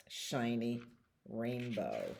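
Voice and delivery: usual speaking voice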